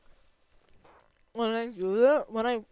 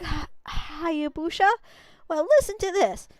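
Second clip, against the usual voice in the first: brash villager; 7 semitones higher than usual. Acted. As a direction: scoff at the little wolf. New villager character voice.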